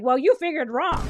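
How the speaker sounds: clipped mock put-down; curt retort. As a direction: shut her down sharply. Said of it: "And he's like".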